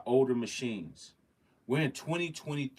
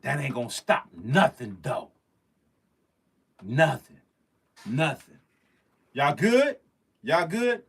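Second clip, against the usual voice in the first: loud defiant calls; 3 semitones higher, 7 dB louder than usual. Real, not acted. Streamer defiant after power cut, calling loudly. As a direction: shout it out defiantly. Defiant then checking viewers.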